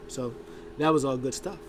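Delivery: ordinary talk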